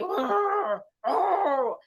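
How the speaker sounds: loud, high growl; growling dog. Acted. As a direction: growl at the fleeing cats.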